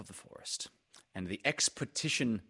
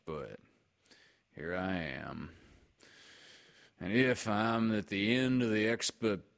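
Eeyore. Acted. Eeyore complains about being last in line, gloomy.

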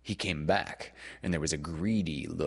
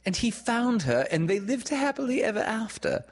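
put-on high, thrilled girl's voice; excited; 4 dB louder than usual. A narrator's reading. Wendy recognising the Cinderella story, high and excited. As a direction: burst out with it, high and a little louder. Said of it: "said Wendy excitedly".

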